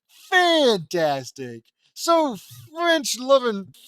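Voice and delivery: loud, very slow mock enthusiasm; sarcastic exclamation. Real, not acted. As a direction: stretch each word out with loud sarcasm. Reader mocks plot twist.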